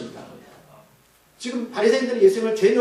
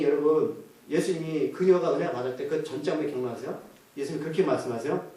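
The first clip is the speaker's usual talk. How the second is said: normal talking voice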